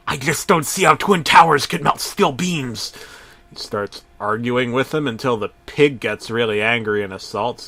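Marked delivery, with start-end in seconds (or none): 0.1-2.9 lightly voiced cartoon conspiracy nut; character voice. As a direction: argue it like a cartoon crank.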